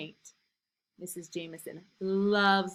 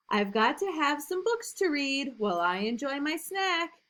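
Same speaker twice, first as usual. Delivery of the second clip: lively, louder storybook reading; reading to children; 3 semitones higher, 4 dB louder than usual. A narrator's reading. Teacher reading a picture book to new kindergartners, lively and loud. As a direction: read it big and bouncy for little kids.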